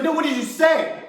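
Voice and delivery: mock stern mother; mom scolding again. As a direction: press the kid for the truth. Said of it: "She's like".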